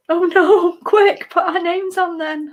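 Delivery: high, slow gasping alarm; hammed-up shock, voice way up; mock panic. Mock panic over missing names.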